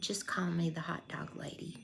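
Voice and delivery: breathy whispered reply, near tears; quiet tearful whisper; hushed whisper. Retold or imitated. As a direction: whisper it like she's been crying. Sad drunk woman crying softly.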